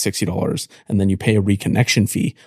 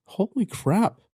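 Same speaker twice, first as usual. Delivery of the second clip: amazed; 10 semitones higher, 4 dB quieter, much slower than usual. Real, not acted. Amazed at Simone again.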